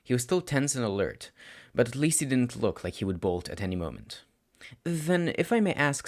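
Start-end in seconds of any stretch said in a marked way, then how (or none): 4.8-6.1 put-on higher, hesitant boy's voice; hesitant teen. Nervous student asking his teacher, high and hesitant. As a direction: ask timidly, testing the water. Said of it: "began the teen hesitantly".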